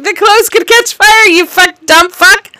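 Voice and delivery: exasperated fake outburst at the screen; mock exasperated yell. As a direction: scold him like an idiot, half-laughing. Mock yelling at movie character.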